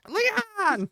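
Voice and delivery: very high, piercing whiny cry; whiny Ashley voice. Retold or imitated. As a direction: shriek the name like a helpless girl. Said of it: "she has this whiny-ass voice".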